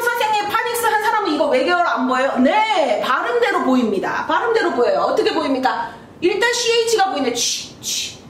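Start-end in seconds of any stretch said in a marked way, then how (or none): 0.0-2.3 mock student question. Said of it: "그러면". 7.3-8.2 취 breathed out as an unvoiced ch hiss; teacher hisses 취 for the class; whispered ch sound. Shown as a demonstration. Teacher shows students the ch sound, whispered. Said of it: "취 발음이 보이죠?".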